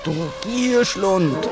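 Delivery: high, slow growling rebuke; growled. A narrator's reading. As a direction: growl it at the glutton. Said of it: "knurrte Löwe". (knurrend schimpfen)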